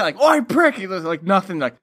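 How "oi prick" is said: high, loud mock yell; imitating a loud, high yell. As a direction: shout it up high to get a rise. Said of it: "after they say that".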